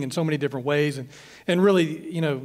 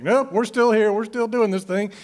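higher and louder, a mock exasperated nope; wry resignation; 6 semitones higher, 3 dB louder than usual. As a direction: shrug it off with rueful humor. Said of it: "I'm like".

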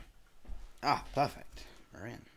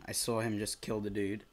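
ordinary talk

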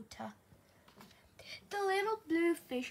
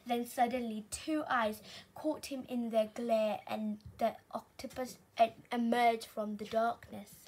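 plain reading voice

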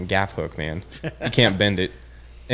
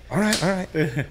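higher-pitched imitation of a partner agreeing; partner's eager agreement; 8 semitones higher, slower than usual. Fishing partner told to bring two rods, imitated high agreement. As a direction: lightly voice a friend going along, pitched up.

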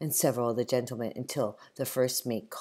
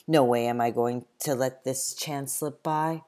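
ordinary delivery

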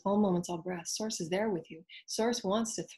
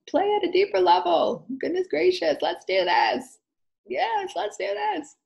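high, loud, gleeful call to play; playful excitement; 12 semitones higher, 9 dB louder than usual. Acted. Speaker urging listeners to play, high excited cheer. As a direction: cheer everyone on like a child.

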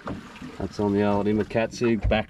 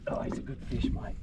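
hushed awe; 11 dB quieter than usual. Real, not acted. Admiring the fish up close.

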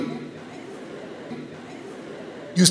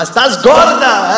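mock yell; 3 semitones higher, 4 dB louder, much slower than usual. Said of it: "le gritaba".

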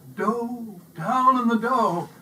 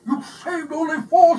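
much higher, loud outraged shout; 10 semitones higher, 11 dB louder than usual. A narrator's reading. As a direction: shout in disbelief. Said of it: "she shouted".

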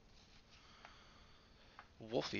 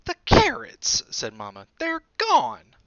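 very high, loud dismayed cry; high loud shocked mother; shocked Mama; 13 semitones higher, 13 dB louder, slower than usual. A narrator's reading. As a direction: gasp it out at the empty cupboard. Mama finds empty cupboard.

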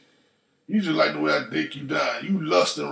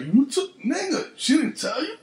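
high, incredulous retort; surprised; 10 semitones higher than usual. Retold or imitated. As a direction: sound amazed he doesn't know. Retold reply, surprised at the news.

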